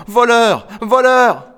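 high, loud, distraught repeated accusation; tearful cry. A narrator's reading. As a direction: cry it out through tears. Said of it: "fondit en larmes en répétant". (cri aigu, en larmes)

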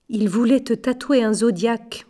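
ordinary delivery, nothing marked

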